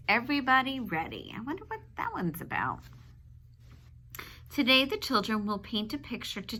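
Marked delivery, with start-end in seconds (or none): none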